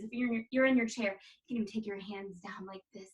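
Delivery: normal talking voice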